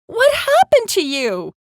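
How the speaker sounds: high, loud emotional exclamation; happy, tearful asking. A narrator's reading. Mom holding her found daughter, loud overwrought happy question. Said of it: "with happy tears running down her cheeks".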